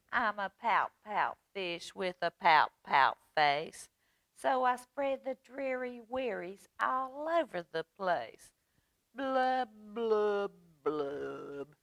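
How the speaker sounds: mock-dreary fish chant; glum pout-pout fish. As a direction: chant it rhythmically, blubs sinking in pitch. Voices the pout fish refrain.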